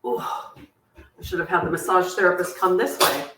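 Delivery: ordinary talk, nothing marked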